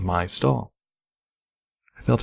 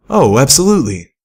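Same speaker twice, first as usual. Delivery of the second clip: higher, louder eager reply; eager yes; 5 semitones higher, 5 dB louder than usual. A narrator's reading. Pip eagerly agrees to help the singer. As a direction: jump at the chance.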